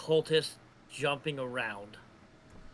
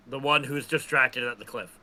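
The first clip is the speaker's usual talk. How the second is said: normal talking voice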